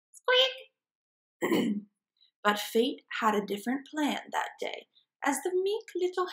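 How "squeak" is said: tiny squeaky hamster noise; hamster squeak. Acted. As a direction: squeak it out much higher.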